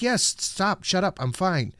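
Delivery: high-pitched retold snap at a fussing doctor; acted testy insistence; irritated brush-off. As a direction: wave off the concern impatiently. Snapping at doctor who keeps asking.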